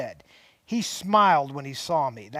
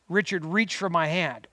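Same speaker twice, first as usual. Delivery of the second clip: usual speaking voice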